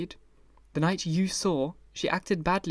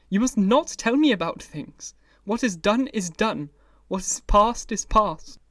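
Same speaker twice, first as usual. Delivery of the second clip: higher, louder insistence; agitated outburst; 5 semitones higher, 5 dB louder than usual. A narrator's reading. Dorian refusing Basil's grief, agitated. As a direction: push him away with words.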